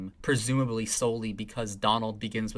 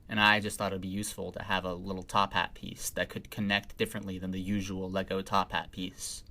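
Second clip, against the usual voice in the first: ordinary talk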